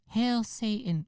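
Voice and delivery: high, squeaky mock-teen voice; hammed-up high adolescent taunt; imitated attention-seeking teen. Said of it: "fifteen-year-old kid trying to disappoint".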